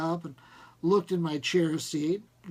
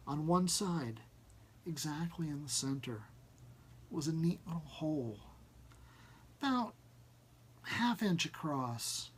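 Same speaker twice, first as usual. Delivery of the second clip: plain reading voice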